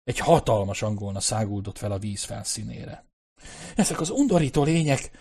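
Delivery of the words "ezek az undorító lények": higher-pitched exclamation of disgust; disgusted cry. A narrator's reading. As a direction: recoil in mild horror. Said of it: "az irtózattól és az undortól felkiáltott". (undorodó felkiáltás)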